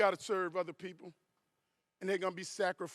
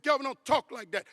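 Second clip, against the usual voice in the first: higher, louder imitation of an upset wife; alarmed protest; 5 semitones higher, 7 dB louder than usual. Wife stopping her husband's talk of a crash, alarmed protest. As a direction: cut in with a raised, scolding plea.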